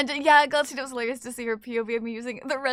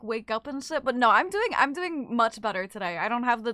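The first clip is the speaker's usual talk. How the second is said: normal talking voice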